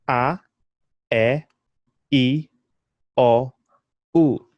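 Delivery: A E I O U as ah eh ee aw oo; Swahili vowels. Shown as a demonstration. Teacher reciting Swahili vowels for learners to copy. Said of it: "how do we say all of those vowels".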